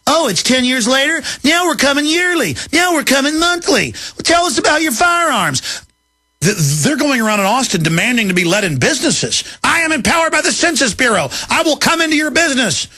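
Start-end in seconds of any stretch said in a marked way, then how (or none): 0.0-5.6 mocking bureaucrat. Radio host mocking census officials' escalating demands, building to a shout. 9.6-12.9 high, puffed-up mock official voice; lightly sneering official; pompous official. Host mocking an agent demanding entry, puffed-up and high.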